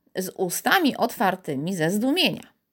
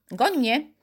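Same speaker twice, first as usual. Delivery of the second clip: higher, playful dare; calling out; 5 semitones higher than usual. A narrator's reading. Invisible Karolcia daring Piotr, playful call. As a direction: invite a chase teasingly. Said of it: "zawołała Karolcia".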